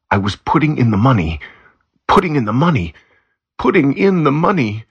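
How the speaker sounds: eager rising repetition. A narrator's reading. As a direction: Vega getting carried away, voice climbing with each repeat. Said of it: "Vega was saying quickly".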